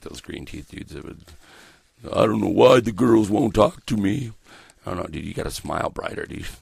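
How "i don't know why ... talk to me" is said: higher, louder mocking whine; mock loser voice. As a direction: imitate a clueless guy complaining about girls. Mimicking green teeth dudes.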